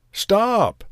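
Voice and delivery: high-pitched cry to halt. A narrator's reading. Old man calls after the runaway gingerbread man, high cry. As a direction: call out sharply upward in pitch. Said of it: "cried the little old man".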